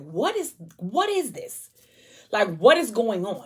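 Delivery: high, cracking shocked question; shocked disbelief. Retold or imitated. Man with a gun pointed at him, shocked and rising. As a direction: ask like a gun just got pulled on you.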